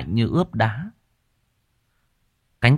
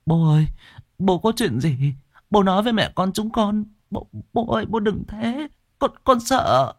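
put-on very high, pleading girl's voice; a scared teenage daughter pleading with her father; frightened daughter; 13 semitones higher than usual. A narrator's reading.

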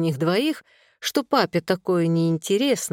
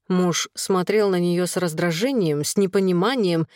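usual speaking voice

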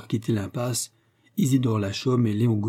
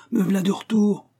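high, joyful shout from afar; calling out; 10 semitones higher, 4 dB louder than usual. A narrator's reading. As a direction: call to him across the garden. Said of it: "cria-t-il de toutes ses forces".

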